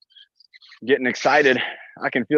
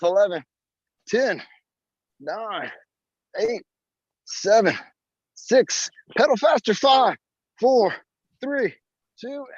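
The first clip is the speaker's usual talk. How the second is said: pushing coach shout; 6 semitones higher, slower than usual. Real, not acted. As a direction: urge them through the final seconds. Coach urging a countdown finish.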